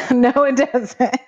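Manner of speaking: high, drawn-out amused denial; laughing. Real, not acted. Co-host amused by the foreign phrase, laughing. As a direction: say it slowly through laughter.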